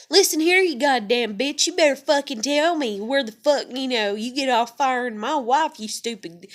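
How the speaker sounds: mock furious tirade, loud and high; cussing, irate caller. Irate caller cussing out staff over his fired wife, furious. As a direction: imitate a caller laying into someone, easing off at the end. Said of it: "I'm talking like".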